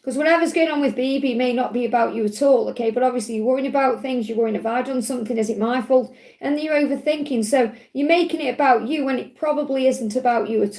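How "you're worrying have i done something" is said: ordinary talk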